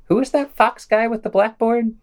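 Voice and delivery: high put-on voice for a mock question; playful high mock voice; mock aside. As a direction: pitch it up like a clueless onlooker. Mocking aside about Glenn Beck.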